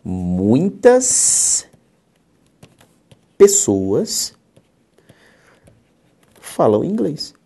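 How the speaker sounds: slow, word-by-word reading with pauses; slow dictation. Shown as a demonstration. Teacher dictating a new example sentence to the class, slow. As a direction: spell the sentence out slowly.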